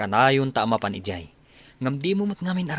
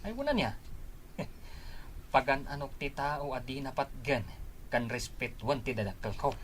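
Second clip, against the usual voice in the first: hushed scoff; 9 dB quieter than usual. Acted. Hushed reply between friends.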